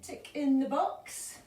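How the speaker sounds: ordinary delivery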